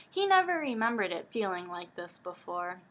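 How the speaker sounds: usual speaking voice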